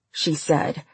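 ordinary delivery, nothing marked

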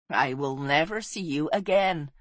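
softly voiced thought to a lost love; inner farewell. A narrator's reading. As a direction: speak it inwardly, as a quiet farewell. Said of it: "she thought".

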